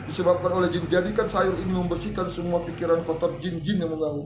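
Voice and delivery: low, even supplication; model prayer. Shown as a demonstration. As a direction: recite a plea over the food being cooked. Models a prayer while cooking. (doa lirih dengan suara rendah)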